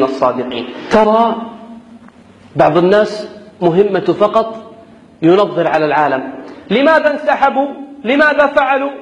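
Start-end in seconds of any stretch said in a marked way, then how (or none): none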